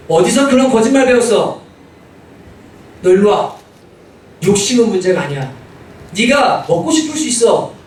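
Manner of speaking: put-on loud, stern rebuke of a lying child; angry scolding. Father scolding his son for lying, loud and stern. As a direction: come down hard on the little liar.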